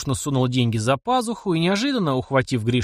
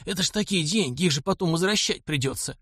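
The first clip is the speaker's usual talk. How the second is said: put-on higher, mildly fearful old man; frightened servant; 5 semitones higher than usual. A narrator's reading. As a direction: fret timidly as an old servant. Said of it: "испуганно глядя на деньги".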